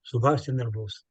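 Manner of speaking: ordinary talk, nothing marked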